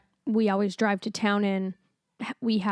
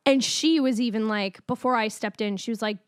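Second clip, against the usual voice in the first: ordinary talk, nothing marked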